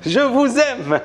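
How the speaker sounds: high, drawn-out declaration of love; gushing declaration. Real, not acted. Streamer to live viewers, gushing affection. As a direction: stretch out each word high, gushing to the fans.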